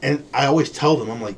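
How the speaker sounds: usual speaking voice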